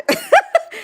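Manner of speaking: high-pitched burst of laughter; excited giggle. Real, not acted. Streamer thrilled at a Japan question, excited giggle. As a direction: giggle high with sheer delight.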